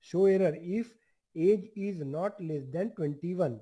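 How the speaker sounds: each word spaced out for students to type; slow dictation. Shown as a demonstration. Teacher dictating to the class, slow and deliberate. Dictating the same message again.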